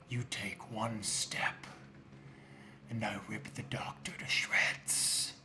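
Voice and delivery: low, soft, slow menacing whisper; sinister villain voice. Acted. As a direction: threaten the heroes from the shadows. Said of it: "this booming voice".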